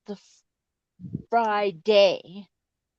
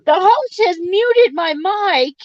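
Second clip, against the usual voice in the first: loud, high-pitched mock announcement; loud high complaint; 9 semitones higher, 9 dB louder than usual. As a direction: belt it out like a protest. Complaining loudly, mock robot line.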